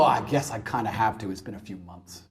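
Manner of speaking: low, resigned and flat; grudging, muttered tone; reluctant sigh. Retold or imitated. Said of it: "She'll look at you and go".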